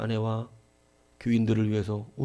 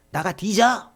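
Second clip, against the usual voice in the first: a raised, higher snarl telling someone to drop dead; mock angry husband; 8 semitones higher, 6 dB louder, much slower than usual. Husband telling his wife to get lost, in a higher, louder voice. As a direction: bark it like a fed-up husband, pitched up. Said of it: "이렇게 말하는 남편도".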